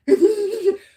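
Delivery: high, loud imitation toddler tantrum noise; mock tantrum wail. Wife mimics husband's fit on the floor, mock tantrum wail. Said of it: "like a two-year-old freaking child".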